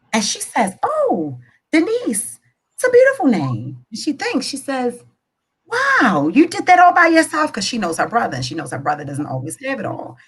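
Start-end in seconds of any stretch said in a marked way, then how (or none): 0.8-3.8 high-pitched surprised approval; pleasantly surprised. Acted. Mother's pleased surprise acted in joke. 5.7-7.5 high, loud mock amazement; impressed. Sister acted, impressed by dim brother.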